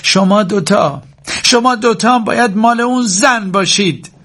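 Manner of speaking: high, strained shout at two people; high-pitched outburst. A narrator's reading. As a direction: cry out at them, pitch way up. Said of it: "فریاد زد".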